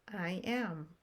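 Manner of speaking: ordinary talk, nothing marked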